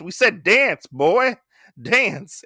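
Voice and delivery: hammed-up impatient thug; bullying command. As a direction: order him about like a storybook bully. Retold bully insists he dance.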